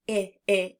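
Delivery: short "I" as in "it", repeated; short i sound. Shown as a demonstration. Teacher contrasts the short "I" with "eh". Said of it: "I hear the short I sound".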